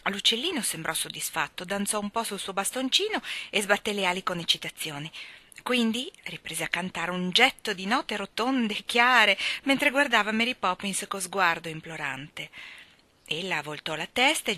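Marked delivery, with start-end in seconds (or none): none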